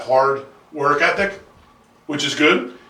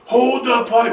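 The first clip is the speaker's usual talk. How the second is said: high, mild mock yell; retold shouted command; imitated yelling dad; 10 semitones higher, 4 dB louder, much slower than usual. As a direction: bark an order as an exasperated dad. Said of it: "He's, like, yelling at me".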